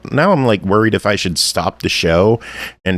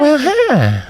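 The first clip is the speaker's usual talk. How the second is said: squeaky high greeting. Pretend. Mock greeting, higher.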